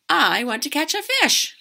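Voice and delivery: a hint of impatient girl; eager kid. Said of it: "and said".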